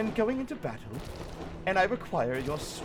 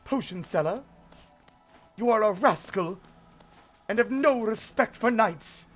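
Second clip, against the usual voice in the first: indignant knight; 6 semitones higher, 4 dB louder than usual. Pretend. Knight scolding merchant.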